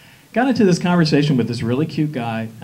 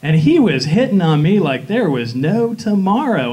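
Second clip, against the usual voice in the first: normal talking voice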